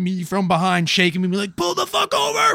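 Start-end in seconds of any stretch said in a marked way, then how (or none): 1.6-2.5 mock yell. Women shaking driver, yelling.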